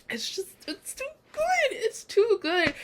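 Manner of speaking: very high, squeaky praise; high fangirl whimper; overwhelmed gushing. Real, not acted. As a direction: squeal it, overcome with delight.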